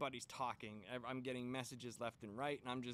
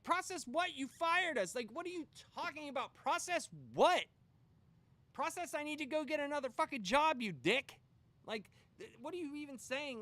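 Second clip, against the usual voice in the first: much higher, louder incredulous yell; outraged shout; 11 semitones higher, 7 dB louder than usual. Retold or imitated. Speaker yelling at the HR lady, outraged. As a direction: blow up at HR.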